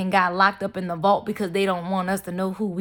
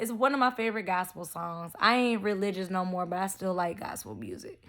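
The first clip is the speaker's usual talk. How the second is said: much softer, muttered afterthought; soft aside; 8 dB quieter than usual. Real, not acted. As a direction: drop the voice for the aside.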